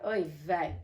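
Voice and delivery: ordinary delivery, nothing marked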